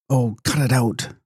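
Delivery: mild exasperated brush-off; lightly voiced, fed up; exasperated cry. A narrator's reading. Dancer cutting off the fortune-telling, mildly exasperated. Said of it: "cried Lavinia".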